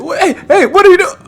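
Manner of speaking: high, loud imitation of a startled victim; mock alarmed yell. Streamer mimicking the abducted man getting probed, high mock-panicked yell.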